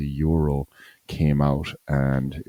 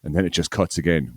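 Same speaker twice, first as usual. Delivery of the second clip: ordinary talk, nothing marked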